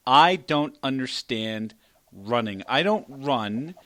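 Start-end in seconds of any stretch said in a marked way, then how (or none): none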